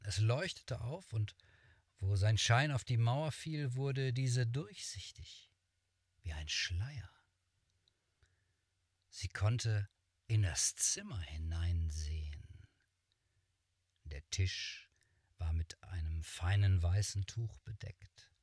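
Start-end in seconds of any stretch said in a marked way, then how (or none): none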